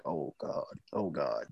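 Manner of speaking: hushed, low "oh God" to oneself; quietly overcome, as retold; retold tearful moment. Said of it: "tears was coming down my face".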